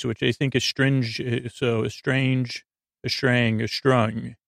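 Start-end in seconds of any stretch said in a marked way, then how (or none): none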